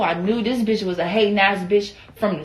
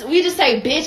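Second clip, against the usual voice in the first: usual speaking voice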